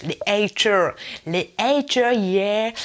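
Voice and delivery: loud, hammed-up American "haters"; mock American accent. Introducing YouTuber slang, hammed-up American accent. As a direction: swagger through it with an American accent. Hams up English word, then normal.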